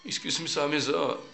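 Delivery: normal talking voice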